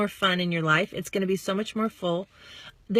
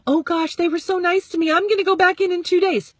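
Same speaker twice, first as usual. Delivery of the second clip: mock eager gush, higher and louder; 9 semitones higher, 7 dB louder than usual. Overeager seller mock gushing about going back. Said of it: "Don't think".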